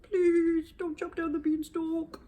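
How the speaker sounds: high, pleading and slow; scared giant. Acted. George, scared halfway down, begging villagers. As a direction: beg fearfully from the beanstalk. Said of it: "he was very scared, he was trembling".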